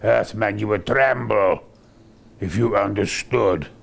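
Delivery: low, menacing emperor's voice; Ming the Merciless. Retold or imitated. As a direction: loom over him as Ming. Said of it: "Ming says things like".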